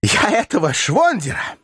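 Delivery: high, slow, vehement threat; furious exclamation. A narrator's reading. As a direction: spit out the threat. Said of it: "воскликнул ... яростно".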